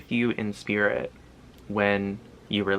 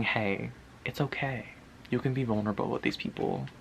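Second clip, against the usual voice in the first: reassuring; 8 dB quieter than usual. Retold or imitated. Spirit voiced reassuring the viewer.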